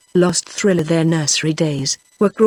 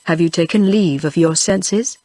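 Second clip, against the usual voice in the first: usual speaking voice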